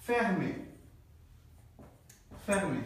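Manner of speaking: "fermé" with a throaty R, like "fehmé"; R pronounced. Shown as a demonstration. Teacher explaining mid-word R, "fermé" with the R sounded. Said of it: "এই রর উচ্চারণ আমার নিতে হবে".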